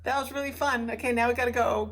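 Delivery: loud, high mock-polite exit; making excuses. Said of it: "that we're like".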